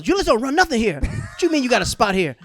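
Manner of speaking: high, loud mock scoff at the newcomers; high loud club man dismissing comics; mock dismissive boss. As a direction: brush them off like a club boss. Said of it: "Julius goes".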